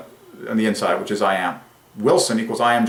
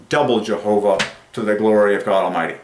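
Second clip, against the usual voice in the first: usual speaking voice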